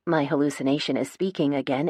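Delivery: ordinary delivery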